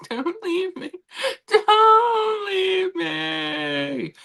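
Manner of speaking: high, hammed-up fake sob, drawn out; mock crying. Wife clinging to husband as he leaves, mock-crying high and drawn out. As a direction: pretend to wail so he won't go.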